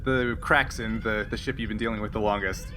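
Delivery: ordinary delivery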